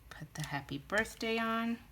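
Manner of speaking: soft whispered aside to herself. Real, not acted. Crafter whispering a step to herself while stamping. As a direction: murmur it under her breath.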